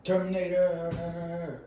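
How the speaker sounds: slow, high drawn-out title call; mock-dramatic announcer drawl; theatrical title echo. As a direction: stretch the word out dramatically.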